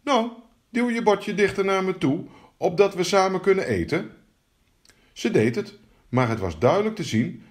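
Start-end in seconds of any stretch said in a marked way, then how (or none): 0.0-4.1 higher-pitched, lightly voiced frog; high, coaxing frog. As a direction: request it in a raised frog voice. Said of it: "Hij zei".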